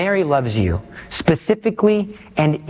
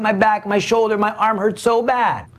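higher-pitched imitation of a suffering mother; retold lament, pitched up; imitated tearful complaint; 4 semitones higher than usual. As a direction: mildly voice a mother crying in pain. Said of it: "I'll see her and she's crying".

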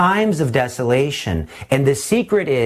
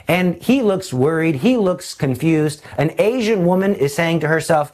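ordinary talk, nothing marked

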